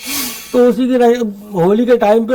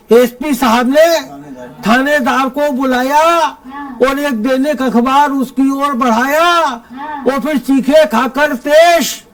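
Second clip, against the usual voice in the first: higher, measured stage verse with ringing line ends; comic poem recitation; 4 semitones higher, slower than usual. Acted. Poet reciting a police satire to a festival audience, declaimed comic verse.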